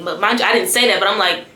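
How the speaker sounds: ordinary talk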